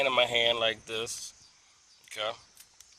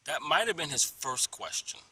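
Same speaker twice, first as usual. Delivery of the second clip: usual speaking voice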